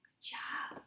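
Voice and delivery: soft whispered good job; whispered praise. Real, not acted. Tutor whispering praise to her reader. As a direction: breathe the praise with no voice.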